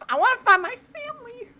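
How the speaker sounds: high-pitched little plea; high, put-on pleading; playful plaintive cry. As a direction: wail it lightly like a lost child.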